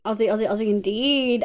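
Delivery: ordinary talk, nothing marked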